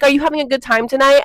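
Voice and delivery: "Are you having a good time tonight?" fast and high like a hyped frontman; imitating band singer. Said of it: "When they're like".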